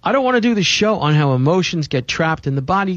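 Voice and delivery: ordinary talk, nothing marked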